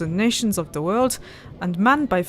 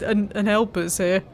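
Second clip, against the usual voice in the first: normal talking voice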